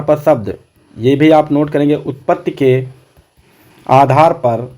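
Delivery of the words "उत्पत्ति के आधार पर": उत्पत्ति के, then a long pause, then आधार पर; pausing to let students write; dictation pace. Shown as a demonstration. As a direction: dictate in short chunks for note-taking. Dictates a phrase to be noted.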